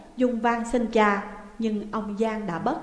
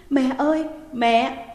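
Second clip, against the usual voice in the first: raised, tearful cry of mother; sobbing call; 3 semitones higher, 3 dB louder than usual. A narrator's reading. Daughter still weeping, calling her mother. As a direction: cry out through sobs. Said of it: "Dung vẫn khóc thảm thiết".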